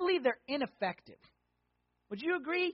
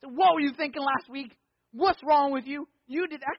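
louder, accusing scold over the head; harsh scolding; 4 semitones higher, 5 dB louder than usual. Acted. Pastor mimics a harsh accuser scolding the congregation, loud and accusing. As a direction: berate them like a nagging critic. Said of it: "God doesn't beat us over the head with it".

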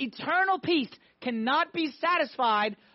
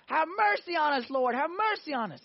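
raised-pitch begging, not truly shouted; mock screaming plea; 7 semitones higher than usual. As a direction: imitate a more insistent cry for mercy. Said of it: "they start screaming more".

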